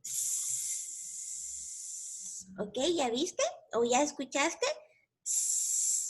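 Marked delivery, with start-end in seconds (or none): 0.0-2.5 very soft, sustained sssss; snake hiss. Shown as a demonstration. Teacher inviting child to make the sound, snake hiss. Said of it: "hacer tú el sonido del snake". 5.2-6.1 gentle short sssss; soft snake hiss. Shown as a demonstration. Teacher showing the snake's gentle sound, soft snake hiss. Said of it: "Su sonido es muy suavecito".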